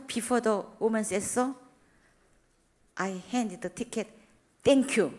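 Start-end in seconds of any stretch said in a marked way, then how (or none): 4.6-5.1 loud, stressing thank you; raised, emphatic point. Real, not acted. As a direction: hammer home the lesson. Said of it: "thank you save the ten dollar".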